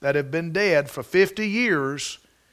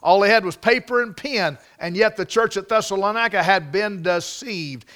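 ordinary talk

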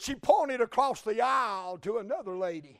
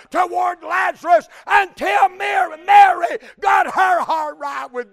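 loud, raised-pitch revival preaching; full-force preaching; 5 semitones higher, 13 dB louder than usual. Real, not acted. Preacher urging his congregation with the lesson of Lazarus, full-force preaching. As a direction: drive it home at full volume.

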